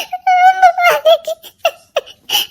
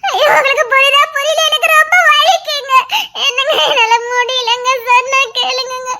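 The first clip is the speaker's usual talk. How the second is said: wailing in pain. Acted. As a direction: sob out the pain to be believed. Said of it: "நீ கத்தாம இரு".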